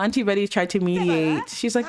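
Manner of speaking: ordinary delivery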